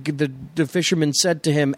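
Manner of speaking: ordinary delivery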